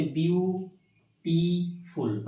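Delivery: Beautiful in three slow, separated beats; broken into syllables. Shown as a demonstration. Teacher counting syllables for students, beautiful slowly split in three. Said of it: "তিনটা syllable হচ্ছে".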